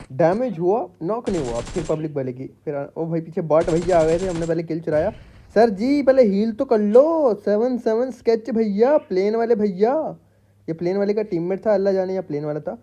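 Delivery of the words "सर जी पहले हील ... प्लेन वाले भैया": mock taunt. Taunting enemy player mid-fight.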